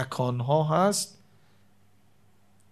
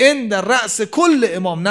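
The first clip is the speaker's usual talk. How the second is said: raised, louder, slowed Arabic phrase; emphatic recitation; 6 semitones higher, 7 dB louder than usual. Acted. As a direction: hammer home the hadith, stressing کل. Repeating the hadith emphatically.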